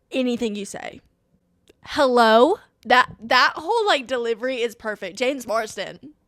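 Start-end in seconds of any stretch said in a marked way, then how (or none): none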